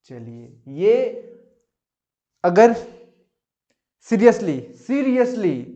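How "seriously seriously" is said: drawn-out seriously. Acted. Teacher mock-exasperated at easy question.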